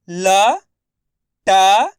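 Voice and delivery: लटक pulled apart letter by letter, loud; very slow sounding out. Shown as a demonstration. Teacher sounds out लटक very slowly, loud. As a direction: drag each letter of लटक. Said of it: "मैं किस तरीके से एक एक अक्षर का उच्चारण".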